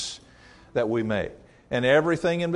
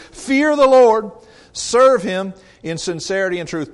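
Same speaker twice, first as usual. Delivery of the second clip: forceful exhortation; 4 semitones higher, 9 dB louder than usual. Shown as a demonstration. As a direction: urge them like Joshua pleading. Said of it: "He's trying to influence their choices".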